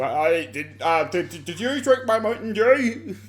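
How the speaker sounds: imitating an awkward fan, pitch climbing; mock fan voice. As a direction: start hesitant, rise to a high mock catchphrase. Mimics fans quoting catchphrase.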